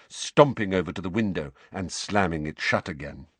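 plain reading voice

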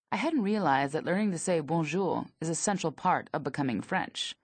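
plain reading voice

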